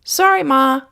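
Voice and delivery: put-on high, small boy voice; boy saying sorry to his mother; sheepish boy. A narrator's reading. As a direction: apologize like a kid.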